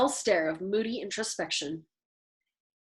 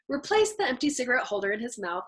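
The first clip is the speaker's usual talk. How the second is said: plain reading voice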